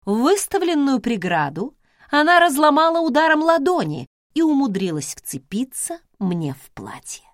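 plain reading voice

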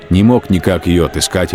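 normal talking voice

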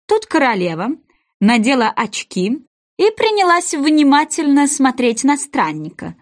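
ordinary delivery

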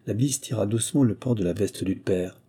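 ordinary delivery

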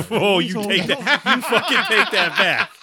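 loud, high, laughing fake indignation; hammed-up demand, laughing through it; mock-offended friend shouting through laughter; mock outrage. Joking outrage at friend's Greek pick.

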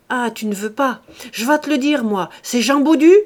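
loud, rising to a shouted guess; angry badgering. A narrator's reading. Farmer guessing the servant's lover, loud and angry. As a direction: throw the name at her as an accusation.

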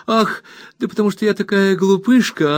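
high self-mocking young wife; high helpless little-wife excuse; wife's helpless excuse. A narrator's reading. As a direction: sigh it up high, playing the silly one. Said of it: "сказала Дора".

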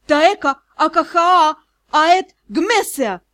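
startled spell cry, stretched vowels; frightened blurting. A narrator's reading. Scared student blurts a spell at the magister, frightened. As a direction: cast it in a panicked outburst. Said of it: "выпалила на одном дыхании, испуганно".

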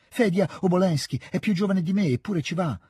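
high, eager argument; boyish protest. A narrator's reading. Petia protests to his father, high and insistent.

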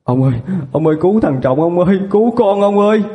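mother begging husband to save their son; panicked pleading. Acted. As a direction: beg the husband to save him. Hysterical mother begs husband.

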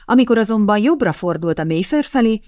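plain reading voice

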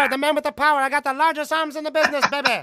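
high, hyped-up wrestler promo; a weird steroided parrot of a wrestler; Superstar Billy Graham. Retold or imitated. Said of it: "'Cause that's what he sounded like".

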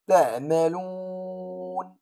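"تعملون" chanted slowly, the و held; recited with stretched madd. Shown as a demonstration. Teacher demonstrating the pause on تعملون, drawn out. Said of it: "أقول".

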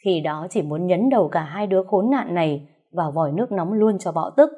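usual speaking voice

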